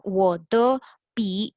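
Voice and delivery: वो द पी said slowly, each syllable clear; slow model sentence. Shown as a demonstration. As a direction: read वो द पी out slowly for learners. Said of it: "मैं कहूँगी".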